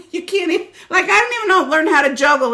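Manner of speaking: laughing. Real, not acted. Laughing over juggling joke.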